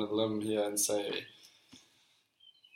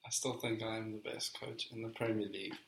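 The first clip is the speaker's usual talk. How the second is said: quiet, level repeat of the quote; softly musing aloud; pondering to himself; 11 dB quieter than usual. Real, not acted. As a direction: think it over under your breath.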